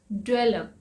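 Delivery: ordinary delivery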